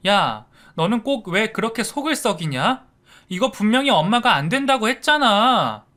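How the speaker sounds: high, loud nagging; scolding big sister. A narrator's reading. Sister scolding her brother for misbehaving, high and loud. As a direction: tell off your little brother.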